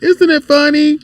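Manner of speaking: put-on high kid's voice asking eagerly; imitating delighted child. Father retells daughter showing him a cartoon, imitated high child voice. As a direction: mimic a child sharing a cartoon. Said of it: "it was something little cartoon shit".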